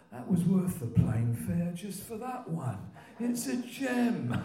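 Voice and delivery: put-on high, loud, drawn-out old-timer's delight; Len B impression. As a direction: build the impersonation to a slow, soaring punchline. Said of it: "He looked down on me".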